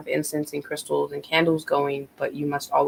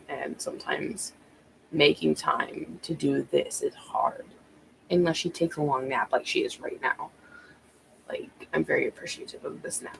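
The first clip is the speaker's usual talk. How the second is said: hushed; 7 dB quieter than usual. Real, not acted. Baby napping, speaks softly.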